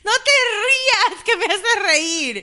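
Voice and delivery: giggling, high and loud; barely able to speak for laughing. Real, not acted. Laughing fit over a blooper.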